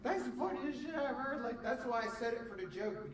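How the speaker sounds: gleeful. Real, not acted. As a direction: cackle over the joke. Laughing at own joke.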